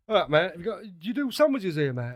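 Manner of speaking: British tourist abroad. Retold or imitated. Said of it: "you get a lot of British people going over there".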